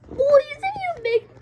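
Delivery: sudden loud call. Real, not acted. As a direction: shout across the room to get their attention. Calling out to the boys.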